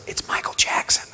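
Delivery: unvoiced, hushed reveal; hushed whisper. Director whispering news of a famous caller. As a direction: whisper it like a secret.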